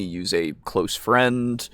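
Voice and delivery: ordinary delivery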